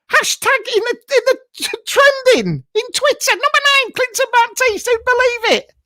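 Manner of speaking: very high-pitched mock amazement; excited boasting. Boasting about trending on Twitter.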